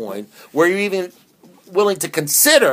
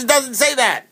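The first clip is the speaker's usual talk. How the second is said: sponsor rebuttal; 10 semitones higher than usual. Retold or imitated.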